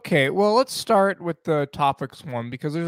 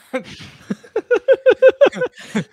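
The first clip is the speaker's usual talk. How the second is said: high loud cackle; loud burst of laughter; 10 dB louder than usual. Real, not acted. Big laugh after joke.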